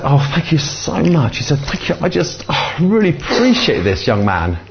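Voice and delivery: high-pitched imitated gratitude; high, effusive mock relief; relieved gushing thanks. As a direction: act out a woman gushing her thanks. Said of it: "totally and utterly relieved".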